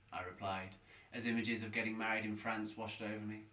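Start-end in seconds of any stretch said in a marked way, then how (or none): none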